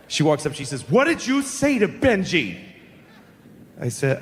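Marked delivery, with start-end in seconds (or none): 0.9-2.6 high, slow, stern demand; imitated furious mother. Benji's mother confronting the seven-year-old, mock furious. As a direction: confront a child as an outraged mother would. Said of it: "and she's furious".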